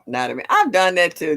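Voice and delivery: usual speaking voice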